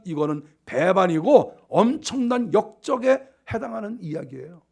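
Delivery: ordinary talk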